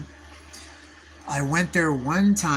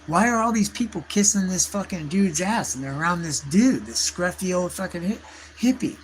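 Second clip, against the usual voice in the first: incredulous rant; 4 semitones higher than usual. Retold or imitated. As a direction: rant in mock outrage at the hangers-on. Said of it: "And I'm like".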